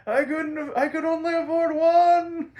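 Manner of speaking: very high, loud cartoon yell; Joker yelling back. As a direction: shout it back over the noise. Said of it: "the Joker yells back".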